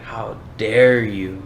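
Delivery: slow, drawn-out outrage, lightly acted; the enemy's voice. The enemy stirring up someone's pride, lightly acted indignation. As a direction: stretch out the tempting enemy's indignation. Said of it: "The enemy sh-, comes in and be like".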